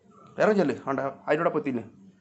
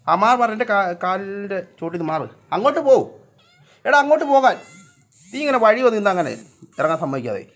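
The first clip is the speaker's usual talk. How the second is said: high, loud scolding; shooing a pet; 7 semitones higher, 7 dB louder than usual. Real, not acted. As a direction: shout the cat out from underfoot. Shooing cat from under feet. (പൂച്ചയെ ഉറക്കെ ശകാരിച്ചു മാറ്റുന്നു)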